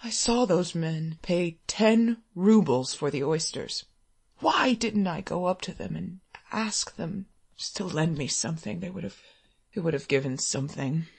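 low, soft self-reproachful mutter. A narrator's reading. A hungry man regrets not asking for a loan, muttering. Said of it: "he was muttering".

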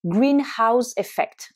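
greenhouse effect said slowly, each syllable clear; slow English pronunciation. Shown as a demonstration. Teacher modelling greenhouse effect slowly. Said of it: "L'effet de serre".

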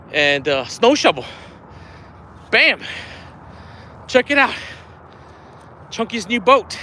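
usual speaking voice

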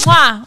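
big loud "mwah"; exaggerated smacking kiss sound; blown kiss. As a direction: send a showy kiss to a fan. Blows a kiss to a donor.

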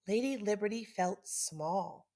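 ordinary delivery

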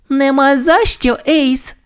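very high cartoon pup reply; pup pilot. A narrator's reading. Sky replies to her idol Ace, high pup voice. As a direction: answer the thanks high-pitched. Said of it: "відповіло щеня-пілот".

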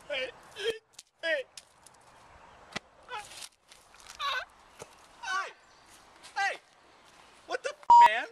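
sudden high furious yelling; angry outburst. Acted. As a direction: blow up at the crew in a rage.

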